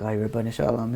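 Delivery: low, hushed plea under the breath; quietly acted guilty panic; sweating with dread. Student hearing of the robbery, murmuring in dread. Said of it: "he's starting to sweat".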